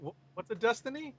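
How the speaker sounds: clueless non-gamer. Retold or imitated. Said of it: "I'm like".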